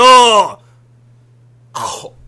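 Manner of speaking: explosive loud yell; shout. Acted. Father yelling at his theatre-mad son, loud shout. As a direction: blast it out.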